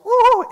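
put-on high excited toddler squeal. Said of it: "she'll go".

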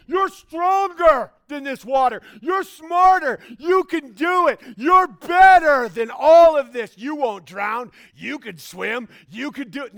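loud, high, slow coach shout; hyped pep talk. Retold or imitated. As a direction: pump the kid up at full volume. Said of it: "another tactic like".

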